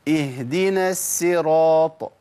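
"اهدنا الصراط" slow, with a thin plain s for ص; Sad turned to Sin. Shown as a demonstration. Teacher imitating a common Fatiha mistake, Sad as Sin. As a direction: say "الصراط" as "as-siraat", no heaviness in the s. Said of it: "إبدالها بسين بيبقى أسهل".

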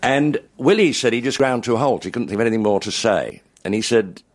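ordinary talk

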